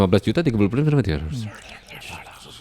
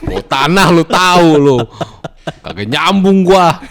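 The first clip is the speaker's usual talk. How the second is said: mock teasing; 3 semitones higher, 6 dB louder, slower than usual.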